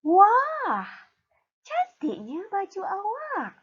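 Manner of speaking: high, slow, gushing wah of delight; admiring exclamation. Shown as a demonstration. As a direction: sound amazed by the pretty shirt. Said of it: "bagaimana untuk membaca ayat seruan".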